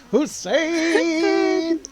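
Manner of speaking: loud, high call of a name. Real, not acted. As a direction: shout a happy greeting across the room. Greeting a new viewer by name.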